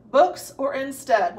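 usual speaking voice

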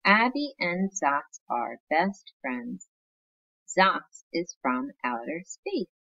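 slow, clear read-aloud storytelling; teacher reading a storybook to her class; reading to children. Acted. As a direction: read it slowly like a picture book to kids.

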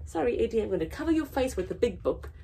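plain reading voice

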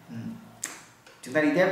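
ordinary delivery

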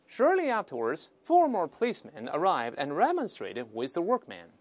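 slow, clear reading of the textbook sentence; reading aloud. Acted. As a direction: read the passage to the class deliberately. Reads English text aloud.

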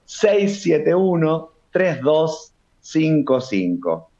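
very slow digit-by-digit dictation; dictado despacito. Real, not acted. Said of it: "Lo voy a decir de nuevo". (despacito, para que lo anoten)

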